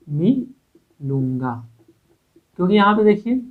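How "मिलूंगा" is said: मिलूंगा drawn out very slowly; slow dictation. Shown as a demonstration. Teacher dictating the sentence to students, very slow. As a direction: stretch मिलूंगा syllable by syllable. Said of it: "यहाँ पे क्या हो जाएगा".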